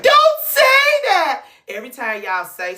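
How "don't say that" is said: high, loud "Don't say that!" shriek; 1 mock shriek. Imitating an anxious mama scolding, a high loud shriek. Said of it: "I'm like".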